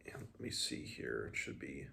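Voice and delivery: plain reading voice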